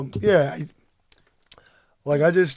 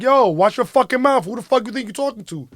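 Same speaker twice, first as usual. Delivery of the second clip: higher, louder, fast confrontational yell; angry retort; 8 semitones higher, 5 dB louder than usual. Retold or imitated. Husband yelling back at the stranger who cursed his family, angry retort. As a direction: snap back hard at her. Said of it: "And I was like".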